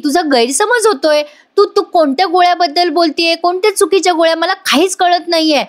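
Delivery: high, rushed mock-innocent protest; fake innocence. A narrator's reading. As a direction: play the wronged innocent, hammed up. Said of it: "ही नौटंकी करते".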